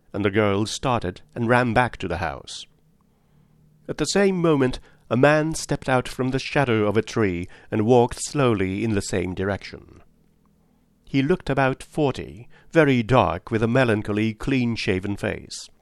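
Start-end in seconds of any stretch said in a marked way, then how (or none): none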